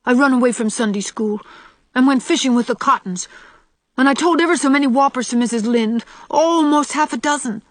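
confession in a rush. A narrator's reading. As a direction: blurt out a child's guilty confession. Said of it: "Out it all came in a rush".

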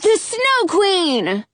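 high, slow call. A narrator's reading. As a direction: call it out proudly. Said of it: "Jonah calls out".

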